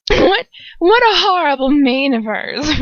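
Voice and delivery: a cackling Death Eater mocking hair; mocking Bellatrix. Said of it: "Bellatrix laughs".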